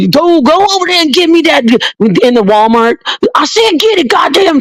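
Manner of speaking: high, fast mock scolding of her kid; mock ratchet mom. Imitating a mother in Walmart ordering her kid, high mock scold. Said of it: "screaming at their kids".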